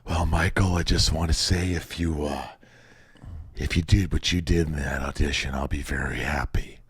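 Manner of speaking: put-on low, soft, raspy-quiet old director voice; a hint of Eastwood's hushed murmur; Clint Eastwood impression. Clint Eastwood murmuring praise to the young actor, low and soft. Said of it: "He goes".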